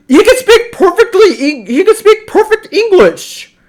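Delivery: very high-pitched, exasperated protest; indignant disbelief. Real, not acted. Reviewer protesting the singer's fake accent, indignant and high. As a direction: voice leaping way up in baffled indignation.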